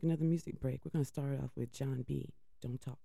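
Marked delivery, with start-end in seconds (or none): none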